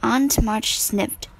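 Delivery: ordinary delivery, nothing marked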